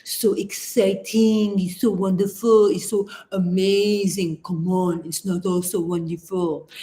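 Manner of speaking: put-on a light French accent, scoffing at Americans; mocking French voice. French critic mocking Americans, in a hint of a French accent. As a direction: tease like a Frenchman who rolls his eyes at American hype, accent only lightly put on. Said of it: "the French make fun of us and they go".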